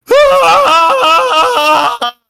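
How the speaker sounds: loud high yelp through an apple in the mouth; muffled gnome cry. Acted. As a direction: shout it with your mouth stuffed full. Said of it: "he has an apple in his mouth".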